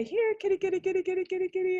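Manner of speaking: high head voice, calling a cat; kitty-calling head voice. Shown as a demonstration. Teacher showing how to call a kitty in head voice. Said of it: "you can practice with me. Say".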